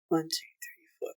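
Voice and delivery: very soft, low count; barely audible muttered counting; counting under breath. Acted. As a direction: count quietly to yourself. Acts out him starting to count.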